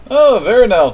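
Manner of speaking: high and loud, delighted; excited high greeting. Real, not acted. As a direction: call out the name with glee.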